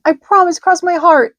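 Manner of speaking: high, loud earnest vow; high loud earnest pig; solemn promise. A narrator's reading. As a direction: promise it with all his heart. Wilbur swears.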